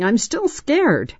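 high, drawn-out fearful complaint; scared and shaking. A narrator's reading. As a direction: insist slowly that the fear remains. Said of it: "Angela said, shaking".

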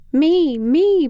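put-on high, loud little-kid chirp; eager toddler. A narrator's reading. Little brother chiming in, a high eager toddler chirp. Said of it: "Patrick added".

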